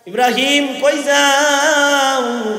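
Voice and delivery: slow, drawn-out motherly call; mother's sing-song question. Acted. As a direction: call after him as he leaves. Mother asks departing son.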